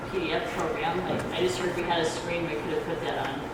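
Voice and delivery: ordinary delivery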